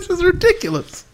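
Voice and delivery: high, giggling this is ridiculous; laughing disbelief. Retold or imitated. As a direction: say it cracking up in disbelief. Retold complaint, laughing.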